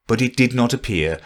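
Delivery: usual speaking voice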